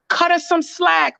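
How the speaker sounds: drawn-out, high mocking mimic of Kyle; mocking a friend's meddling. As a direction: sneer it back slowly like a meddler. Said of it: "Kyle butting in".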